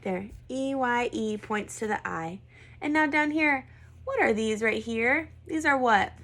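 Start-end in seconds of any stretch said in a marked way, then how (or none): none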